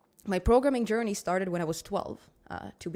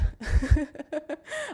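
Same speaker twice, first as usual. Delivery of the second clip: laugh; 8 semitones higher, 6 dB louder than usual. Real, not acted. Laughs at her own feedback remark.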